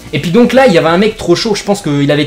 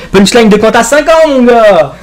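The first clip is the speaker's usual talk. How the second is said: loud, higher, slower bragging call; hyped boast; 7 semitones higher, 7 dB louder, slower than usual. Real, not acted. Narrator bragging to viewers about his punchline, loud higher hype. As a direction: hype his own comeback like a battle rapper.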